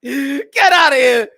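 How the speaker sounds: loud, drawn-out mock yell; slow, booming comic send-off; hammed-up dismissal. Man chasing her off the luggage, drawn-out shout.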